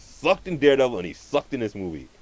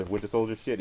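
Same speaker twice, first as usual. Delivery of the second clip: ordinary delivery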